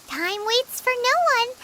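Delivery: ordinary delivery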